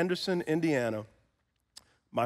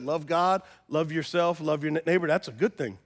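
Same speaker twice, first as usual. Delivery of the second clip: normal talking voice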